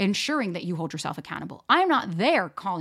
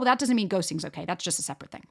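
normal talking voice

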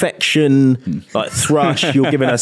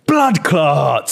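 mock road rage. Acting out being cut off in traffic, mock road rage. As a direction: curse a driver in pretend annoyance. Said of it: "You cut me off in traffic".

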